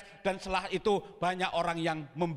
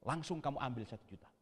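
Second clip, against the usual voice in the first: soft, low divine command; voice of God; 4 semitones lower, 9 dB quieter than usual. Retold or imitated. God telling the preacher to withdraw money, hushed voice. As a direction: give a hushed instruction from above. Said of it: "Tuhan ngomong gini".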